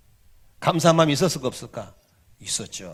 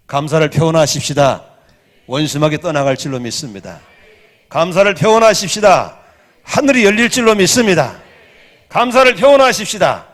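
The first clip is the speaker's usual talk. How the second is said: much louder refrain, rising each time; building shout; 13 dB louder than usual. Real, not acted. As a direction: build it up to a preaching roar.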